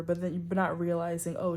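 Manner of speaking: normal talking voice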